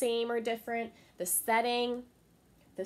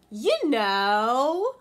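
louder, drawn-out sing-song; teasing deer; 5 semitones higher, 11 dB louder than usual. Acted. Little Bush Deer teasing Anansi, sing-song. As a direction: draw it out to tease him. Deer says the trick words.

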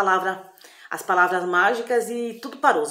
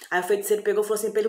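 ordinary delivery

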